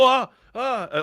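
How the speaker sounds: high, whiny mock "oh, oh"; a whining troll caught out; mock whine. Mocking a troll who gets flamed back, high whiny yelp. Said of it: "And they go".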